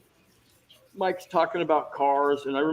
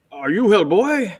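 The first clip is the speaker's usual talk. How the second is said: louder, higher, slowly drawn-out question; director's line reading; 5 semitones higher, 5 dB louder, slower than usual. Retold or imitated. Director showing the actor his line, imitated loud and drawn out. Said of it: "what you're gonna say is".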